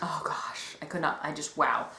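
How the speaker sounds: whispered in disbelief; stunned whisper. Real, not acted. Fan still stunned by the episode, whispering. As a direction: breathe it out, speechless. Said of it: "stunned disbelief".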